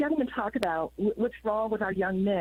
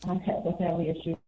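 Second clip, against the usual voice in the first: on speakerphone; 2 semitones lower than usual. Real, not acted. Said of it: "sound like you're on speakerphone".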